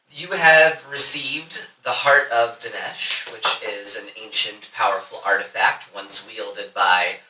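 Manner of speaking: game master narration. A narrator's reading. GM describes the artifact.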